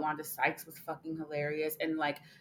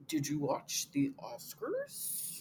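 slow put-on voice, rising at the end; Elizabeth Holmes; 7 semitones higher, 5 dB quieter, much slower than usual. Co-host feeds the question for a mock reenactment, slow impersonation. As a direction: draw the question out in an affected voice. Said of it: "your Elizabeth Holmes voice".

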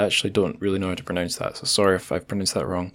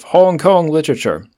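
high, loud bold-type emphasis; bold text voice; 7 semitones higher, 7 dB louder than usual. Shown as a demonstration. Host reading bullet points from blurb, bold text voice. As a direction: blare it out as if in bold print. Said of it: "bold text voice is getting worse".

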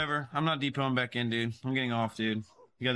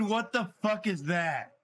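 ordinary talk

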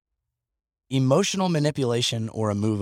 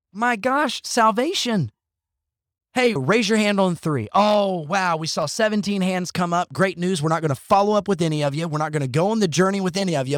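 higher-pitched, sarcastic church announcer; mock pastor excitement; 4 semitones higher than usual. Podcaster mocking pastors who count raised hands, sarcastic excitement. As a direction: parody a thrilled altar call, pitched up.

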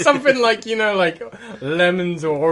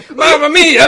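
loud, high, hammed-up Italian; Italian accent; 6 semitones higher, 10 dB louder, slower than usual. Player asked if he is Italian, hammed-up Italian accent. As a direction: burst out as a mock Italian. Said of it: "Are you being Italian?".

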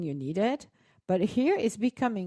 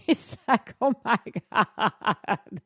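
laughing; much slower than usual. Real, not acted. Laughing over insurance quote chaos.

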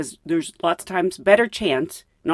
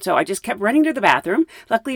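ordinary talk, nothing marked

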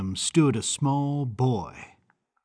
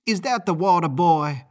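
higher, sharp snap; snapped; 5 semitones higher, 4 dB louder than usual. A narrator's reading. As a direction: snap it with contempt. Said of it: "she snapped".

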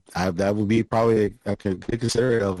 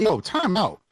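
incredulous outburst; 11 semitones higher than usual. Retold or imitated. Retold outburst at friends.

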